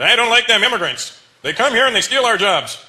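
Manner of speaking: high, loud, slow mock complaint. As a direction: caricature an indignant bigot, stretched out. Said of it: "when I hear racist people say that".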